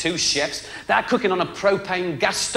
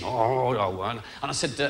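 normal talking voice

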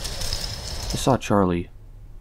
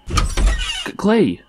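startled greeting; 9 semitones higher than usual. Acted. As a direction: blurt the name, higher pitched. Startled as the door opens.

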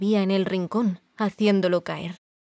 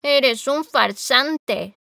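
very slow, higher and louder; complaining accusation; 5 semitones higher, 4 dB louder, much slower than usual. A narrator's reading. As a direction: accuse him slowly and bitterly. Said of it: "en tono quejoso". (acusación quejosa, muy lenta)

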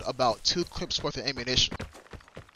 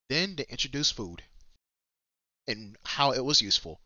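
ordinary delivery

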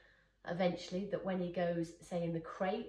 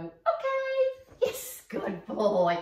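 put-on very high, loud squeal then drawn-out good boy; excited praise; 4 semitones higher, 12 dB louder, much slower than usual. Trainer praising her dog Stanley, loud excited squeal. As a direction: gush over him like he's won. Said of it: "and I'm gonna go".

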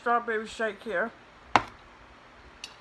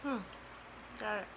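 talking while chewing food; muffled words around a bite; mouth full, chewing; 2 semitones higher, 9 dB quieter, faster than usual. Real, not acted. Seen 2: Just ate from hands; cheeks and lips move as if chewing.

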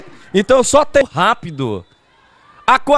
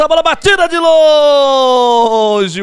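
excited call; 2 semitones higher, slower than usual. Real, not acted. Shot from distance commentary.

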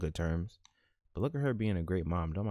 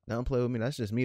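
ordinary talk, nothing marked

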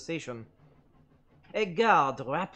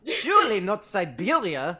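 louder alarmed protest; exclaimed; 3 semitones higher, 7 dB louder than usual. A narrator's reading. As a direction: blurt it out in dismay. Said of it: "I exclaimed".

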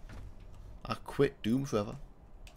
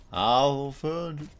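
mock menacing taunt; 7 semitones higher, 4 dB louder, slower than usual. Taunting a hidden enemy.

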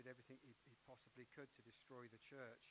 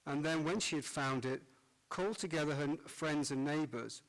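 cathedral echo; 3 semitones higher, 21 dB louder than usual. Acted. Priest proclaims Gospel in church.

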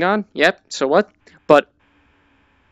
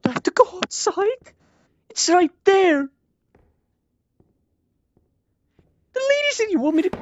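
much higher put-on voice for the game lady; character voice.

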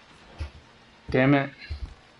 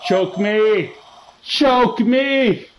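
high, insistent call; 6 semitones higher, 3 dB louder, much slower than usual. Real, not acted. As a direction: call it out high, more forceful the second time. Calling out to game character.

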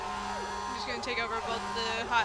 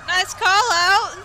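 excited shout; 7 semitones higher, 12 dB louder, much slower than usual. Real, not acted. Cheering a teammate's good callout.

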